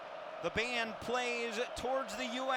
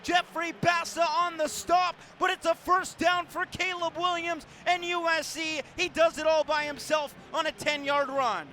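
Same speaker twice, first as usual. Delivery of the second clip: excited scramble call; 10 semitones higher, 6 dB louder than usual. Real, not acted. Sportscaster calls the quarterback's run to the five, excited shout. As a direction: let the run carry the voice up.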